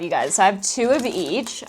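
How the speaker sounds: normal talking voice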